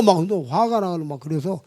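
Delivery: usual speaking voice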